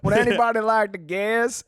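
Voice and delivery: Southern showman asking the crowd; Southern accent. Shown as a demonstration. Guest inviting an imagined crowd to guess, Southern accent. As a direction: put the question in a Southern accent. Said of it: "I'll just do it in the accent".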